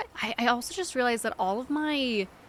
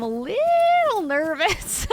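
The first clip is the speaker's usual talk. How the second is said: playful nervous squeal; 10 semitones higher, 7 dB louder, much slower than usual. Real, not acted. Admits nerves, playful stretched squeal.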